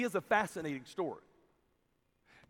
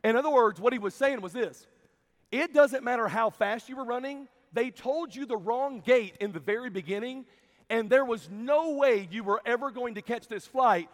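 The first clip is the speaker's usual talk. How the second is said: preaching at full force; 3 semitones higher, 5 dB louder than usual. Real, not acted. Preacher drives home the lesson.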